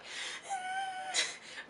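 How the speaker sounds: very high laughing squeal. Real, not acted. Recalling the concert, a high soft squeal of emotion. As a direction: let out a thin high squeal. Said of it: "overcome recalling the night".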